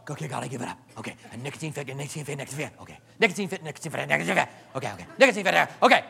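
low, hushed, strained smoker fighting a craving; mock craving smoker. Preacher mimics a quitting smoker, hushed and strained. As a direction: mutter through the craving like a desperate quitter.

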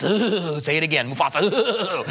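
lightly voiced, giggling cartoon hyena moaning "ooh"; hyena impression. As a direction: shiver at the name like a spooked hyena, half laughing. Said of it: "and you had the hyenas".

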